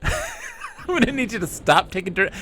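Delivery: laughing. Real, not acted. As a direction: giggle through the words. Laughing request.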